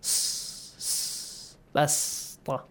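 hissed s twice, then a soft "basta"; quiet demonstration of sin's s in "basta"; sad as sin. Shown as a demonstration. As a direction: isolate the thin s, then hold it in "basta". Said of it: "sound of Sad like a sin".

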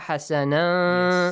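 hasana chanted slowly, its ending hummed long; ikhfa. Shown as a demonstration. Teacher showing the next ikhfa, hasana with drawn-out nasal ending. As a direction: hold the final n sound of hasana. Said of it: "But next one is ikhfa".

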